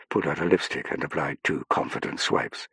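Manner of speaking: plain reading voice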